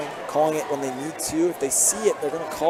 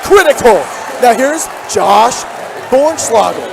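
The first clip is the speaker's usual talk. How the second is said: much higher, loud and breathless; excited shout; 9 semitones higher, 11 dB louder than usual. Real, not acted. Commentator calling the critical layup, loud excited shout. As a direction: shout the open layup as it drops.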